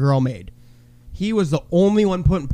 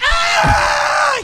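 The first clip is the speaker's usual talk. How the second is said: sharp high yelp; a sudden high cry of dismay; shocked yelp. Retold or imitated. Storyteller yelping as the buzzer goes off.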